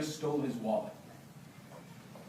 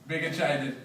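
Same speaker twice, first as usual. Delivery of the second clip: ordinary talk, nothing marked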